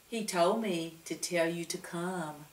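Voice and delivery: Lucia soft voice. A narrator's reading.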